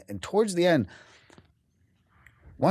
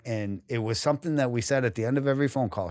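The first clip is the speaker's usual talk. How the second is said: ordinary talk, nothing marked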